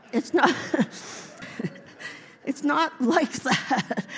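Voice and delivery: talking through real laughter; slow, breaking up with laughter; laughing. Real, not acted. Preacher cracking up at own Bible joke, laughing.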